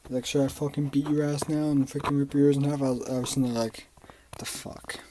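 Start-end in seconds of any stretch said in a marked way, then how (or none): 0.3-2.8 loud, angry threat; imitating dad threatening violence; dad's threat. Dad threatening to beat his son, loud and angry. 4.4-5.0 whispered in disbelief. Retold or imitated. Son stunned by his dad's threat, whispered.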